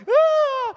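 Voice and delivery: high, loud shriek of terror; mock scream. Guy in photo screaming at a small woman, hammed-up shriek. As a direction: shriek like a terrified big guy. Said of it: "He's like".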